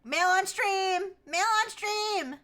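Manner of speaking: slow, drawn-out high call to chat. Real, not acted. Streamer announcing to her chat, drawn-out high call. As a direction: stretch it out like an announcement.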